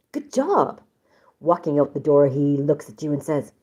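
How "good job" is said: cheery high good job. Acted. Voicing subject one's cheerful line.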